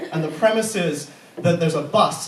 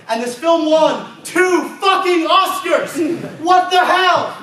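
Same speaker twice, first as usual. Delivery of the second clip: loud, higher disbelieving yell; mock outrage shout; 9 semitones higher, 6 dB louder, slower than usual. Real, not acted. Outraged at the Oscars.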